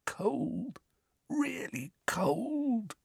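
high, soft, haunted croak. A narrator's reading. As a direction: croak it slowly, still shaken. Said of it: "croaked Dudley".

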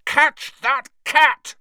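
high, loud, slow yell. Acted. Ron yelling as Scabbers flees the cat. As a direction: yell it across the room. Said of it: "Ron yelled".

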